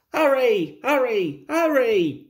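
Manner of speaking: falling, punched-out calls to hurry; panted urging. A narrator's reading. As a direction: urge them on, lightly puffing. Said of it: "panted Thomas".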